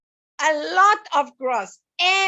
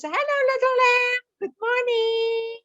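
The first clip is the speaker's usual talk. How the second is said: sheep greeting; 8 semitones higher than usual. Acted. Teacher voices sheep greeting lamb.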